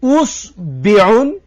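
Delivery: اسبعون slowly as usbiun, u then i; drawn-out اسبعون starting with u; eighth variant. Shown as a demonstration. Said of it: "هشتم نمودش".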